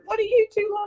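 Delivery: high, affronted demand; indignant Dad. Retold or imitated. Dad annoyed at his laughing wife and daughter, hammed-up indignation. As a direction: bristle at the laughing pair. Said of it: "Mum and I are absolutely rolling around".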